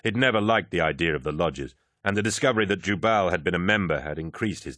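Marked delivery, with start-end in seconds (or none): none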